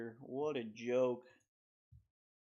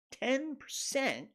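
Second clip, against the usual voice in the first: Ten much higher, stretched slowly; stressed disbelief; 10 semitones higher, much slower than usual. Real, not acted. Outraged at spending on a stadium, stressing Ten. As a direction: lean hard on Ten in disbelief.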